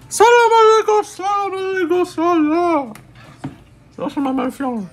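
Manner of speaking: high, loud called-out greeting; loud sing-song greeting. Pretend.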